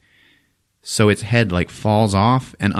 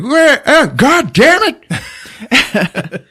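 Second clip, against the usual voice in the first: high, loud slow pained outcry; mock anguish; 5 dB louder, much slower than usual. Finn sees Rose shot, high loud mock anguish. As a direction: wail it as your friend falls. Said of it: "Finn's like".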